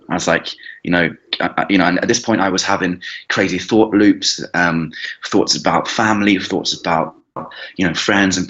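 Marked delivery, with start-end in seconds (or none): none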